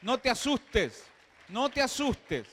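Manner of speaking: No te asustes called out loud and high; loud exhortation. Shown as a demonstration. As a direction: shout No te asustes to the crowd. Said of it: "Dile al vecino".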